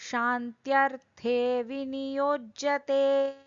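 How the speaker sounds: over enunciating. Acted. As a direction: intone each syllable slowly and deliberately. Recites Sanskrit verse, then explains.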